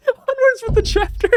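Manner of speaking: high laugh-choked words; squeaking through laughter. Real, not acted.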